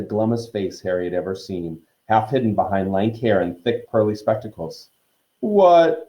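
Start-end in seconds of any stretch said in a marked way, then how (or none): 5.4-6.1 loud, high sulky retort; petulant ghost, pitched up. A narrator's reading. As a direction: throw it out peevishly. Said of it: "she said sulkily".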